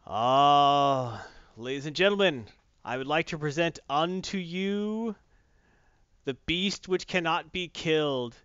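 booming, slow grand proclamation; mock biblical prophet. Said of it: "I said you don't fear it".

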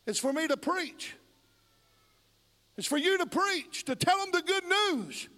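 high-pitched, emphatic preacher's exhortation; impassioned preaching. Real, not acted. Preacher charging the congregation to tell the good news, impassioned.

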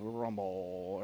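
low, rumbling drawn-out GRUMBLE; garbage disposal grumble. Shown as a demonstration. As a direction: growl the word like a garbage disposal. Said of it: "like a garbage disposal".

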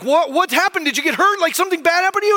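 put-on rushing in to a screaming child, voice up in alarm; alarmed dad. Dad asks crying son what happened, high alarmed retelling. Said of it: "and I was like".

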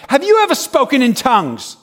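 imitated preacher's loud interrogation; accusing challenge. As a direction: challenge a stranger in front of the crowd. Street preacher challenging someone.

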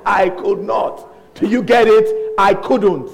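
loud, slow, word-by-word insistence; retold exasperated Jesus. Jesus correcting the preacher the fourth time, exasperated insistence. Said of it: "About the fourth time, it's like Jesus said".

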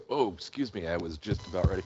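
soft strained voice after choking; recovering from a coughing fit; hoarse after coughing. Real, not acted. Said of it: "that went down the wrong way".

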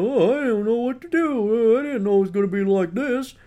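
put-on lost student voice; mock clueless freshman.

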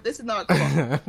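laughing through the words; real laughter while speaking. Real, not acted. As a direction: laugh it out, embarrassed.

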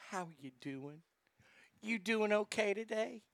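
mocking tender check-in; mock sympathy. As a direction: coo it like a gentle host. Imitating hosts' sympathy.

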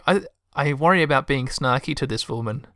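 ordinary talk

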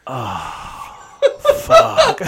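breaks into a high laugh on fuck. Retold or imitated. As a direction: laugh through the realisation. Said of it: "And I was like".

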